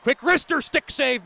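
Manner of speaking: sudden jump in pitch on the shot; excited call. Real, not acted. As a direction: punch it out as the shot fires. Shot on goal in play-by-play.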